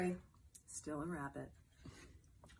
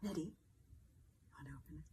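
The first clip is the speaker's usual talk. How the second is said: bright, playful read-aloud voice; reading to a toddler; 4 semitones higher, 4 dB louder than usual. Acted. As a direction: read it like a bedtime picture book for little ones. Celebrity reads picture book to kids.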